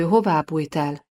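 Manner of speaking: ordinary delivery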